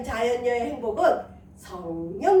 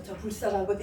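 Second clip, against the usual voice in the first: muttered under breath; 7 semitones lower, 7 dB quieter than usual. Retold or imitated. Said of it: "자기 속으로 뒤통수 보면서".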